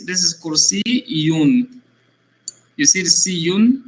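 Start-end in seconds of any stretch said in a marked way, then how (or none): 0.0-1.8 Kursiyun drawn out slowly; slow Arabic model. Shown as a demonstration. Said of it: "This is how you see".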